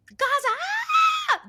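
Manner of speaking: very high mock scream with a drawn-out "ah"; imitating screaming crowd. Fans hearing the word Gaza, imitated screaming. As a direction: shriek it like an excited fan. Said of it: "there were some people screaming".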